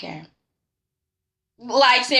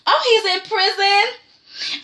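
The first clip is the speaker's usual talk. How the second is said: higher, slow fake-casual reply; mock innocent Chloe; 7 semitones higher, slower than usual. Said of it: "She's like".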